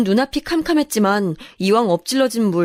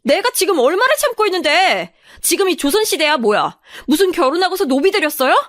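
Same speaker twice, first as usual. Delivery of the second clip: high, indignant outburst; boiling-over anger; 8 semitones higher than usual. Acted. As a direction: let pent-up resentment burst out. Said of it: "속에서 우라통이 터지더라고요".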